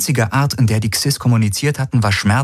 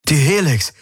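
high-pitched sudden outcry; exclaiming; 7 semitones higher than usual. Acted. Galen realises the Helix is blocking them, exclaiming. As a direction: cry out the discovery. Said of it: "rief Galen aus".

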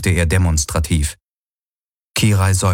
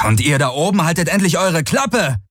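angry shout; 9 semitones higher than usual. Acted. Galen shouting at the cawing birds overhead, angry yell.

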